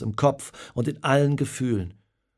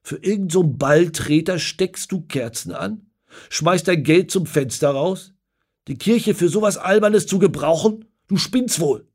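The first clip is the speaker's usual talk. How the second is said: loud, higher, furious tirade; angry scolding; 7 semitones higher, 6 dB louder than usual. Acted. Father scolding his son outside the pub, furious. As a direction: tear into the boy. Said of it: "schimpft der Vater wütend".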